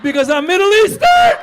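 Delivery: very high, loud exclamation; hammed-up indignant yell; outraged realization. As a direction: shout it in comic disbelief. Outraged realization about costume request.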